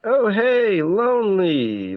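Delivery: high, slow mock greeting. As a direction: drawl the name out in a high, mocking voice.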